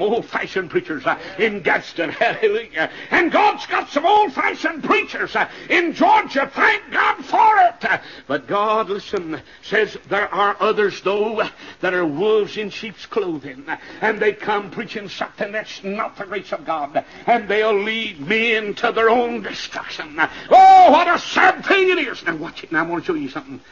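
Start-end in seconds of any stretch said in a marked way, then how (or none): 3.1-7.8 high, ringing pulpit cry; high-pitched preacher's shout; exultant preaching. Real, not acted. 20.5-22.2 high wail opening on a loud oh; loud, high-pitched lamenting; preacher's loud lament. Real, not acted.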